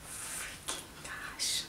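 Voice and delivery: slow, breath-only whisper of disbelief; stunned whisper. Real, not acted. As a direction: whisper it slowly, overwhelmed. Stunned by the gift.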